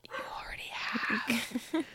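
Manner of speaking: slow, breathy stage whisper; hushed, emotional whisper. Retold or imitated. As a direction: whisper it slowly, acting out the character. Retelling an emotional confession.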